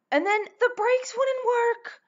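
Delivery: high-pitched, loud, agitated storytelling; alarmed retelling. Real, not acted. Storyteller recounting failed brakes, high and alarmed. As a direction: pitch it way up as the danger hits.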